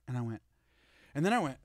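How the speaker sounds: usual speaking voice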